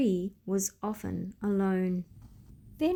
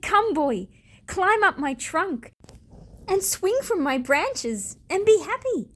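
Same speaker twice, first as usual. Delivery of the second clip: joyful tree; 8 semitones higher, 5 dB louder than usual. A narrator's reading. Tree shook with joy.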